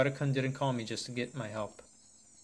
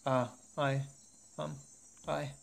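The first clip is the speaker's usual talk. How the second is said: fearful; 3 semitones higher, slower than usual. Acted. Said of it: "There are fear and certainties in his voice".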